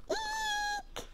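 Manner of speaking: high thin squeak of eek; mock squeal. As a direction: squeak like a startled mouse.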